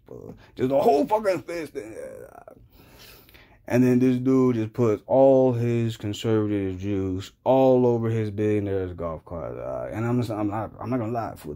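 normal talking voice

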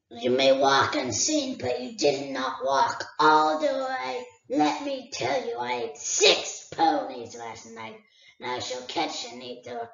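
a great dragon toying with a thief; dragon Smaug. Acted. Smaug warns Bilbo.

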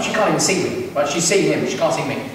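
ordinary talk

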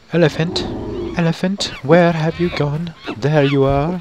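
high-pitched calling voice; playful calling out. Pretend. Player searching for his elephant, playful high calling. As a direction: call high for a lost pet.

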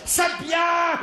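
high delighted exclamation; a woman cheering enthusiastically; mock ecstatic cry; loud approving lady. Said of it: "elle le disait très fort".

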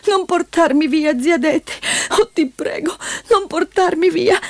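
put-on high little girl, begging tearfully; high, whimpering child's plea; tearful pleading. A narrator's reading. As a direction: plead as if about to cry. Child begs not to be taken.

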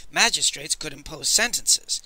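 usual speaking voice

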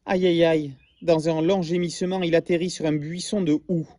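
lively storybook read-aloud; story narration. A narrator's reading. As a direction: tell it like a bedtime tale to children. Reads story to class.

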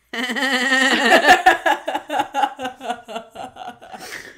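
long forced laugh, swelling then fading; bad fake laugh. Monk flipping the table on show night, awful fake laugh. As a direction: imitate a terrible actor's laugh. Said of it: "It's so bad".

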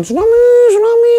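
high, mock cry of alarm; imitated crowd shouting. Survivor imitating people yelling tsunami, high mock shout. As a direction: imitate people calling out a warning, pitch climbing. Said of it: "Teriak-teriak kan orang kan".

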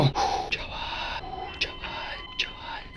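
tense whisper. Real, not acted. Gamer to himself after a close call, tense whisper. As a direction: whisper it over and over between pauses.

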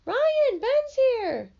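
high, loud shriek of names; excited scream. A narrator's reading. Little sister screaming for Ryan and Jenny at the door. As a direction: shriek the names through the house. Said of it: "she screams".

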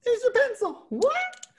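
very high, puzzled silly voice; cartoonish high what; mock confusion. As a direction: play dumb for the children. Feigned confusion during pen trick.

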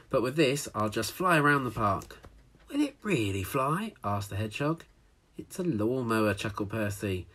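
lively read-aloud storytelling voice; story narration. A narrator's reading. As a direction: read it like a picture book to children.

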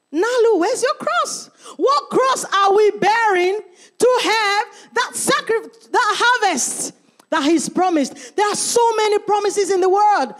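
loud, high preaching at full force; fiery preaching. Acted. Preacher crying out to the church about their cross. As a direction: cry it out to the congregation.

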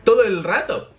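high, animated disbelief; exasperated amazement. Real, not acted. Exasperated at nonstop chapter events.

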